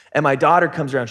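ordinary talk, nothing marked

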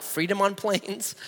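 ordinary delivery

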